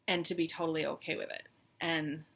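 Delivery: ordinary talk, nothing marked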